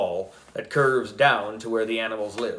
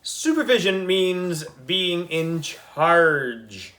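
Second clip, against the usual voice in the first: scornful correction; 5 semitones higher, much slower than usual. A narrator's reading. Andrea corrects boy scornfully.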